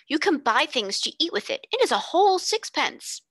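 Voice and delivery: put-on a small boy who will not take no; insisting stoutly. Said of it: "he insisted stoutly".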